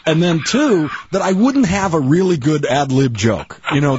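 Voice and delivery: usual speaking voice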